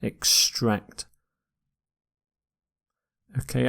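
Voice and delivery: usual speaking voice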